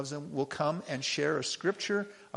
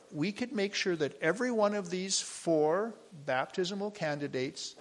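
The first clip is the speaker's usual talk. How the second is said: usual speaking voice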